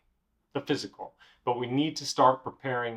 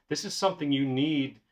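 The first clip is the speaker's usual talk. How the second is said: ordinary talk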